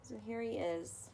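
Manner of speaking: usual speaking voice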